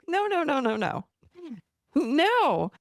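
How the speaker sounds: high, urgent string of no's; emphatic objection. Retold or imitated. Coach stopping clients who yelled at their part, emphatic objection. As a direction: cut in with a high-pitched protest.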